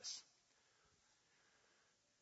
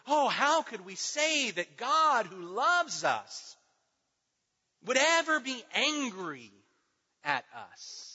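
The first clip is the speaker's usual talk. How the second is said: higher, slow, mock-incredulous whine; mocking incredulity; 4 semitones higher, 4 dB louder, slower than usual. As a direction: stretch it out like a shocked skeptic.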